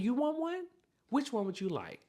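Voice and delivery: high-pitched customer-service voice; composed cashier. Retold or imitated. Cashier offering the customer a bag, high and composed. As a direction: ask it high and sweetly polite after a breath. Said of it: "But I took a breath".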